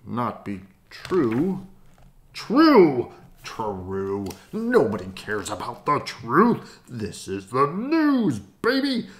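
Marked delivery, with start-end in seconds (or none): 2.3-9.1 scoffing boss. Acted.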